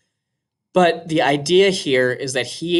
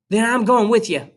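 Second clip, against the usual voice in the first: higher, louder choked-up insistence; girl's higher voice, choked and pleading; 8 semitones higher, 3 dB louder than usual. Acted. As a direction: force it out past a tight throat. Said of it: "I choked".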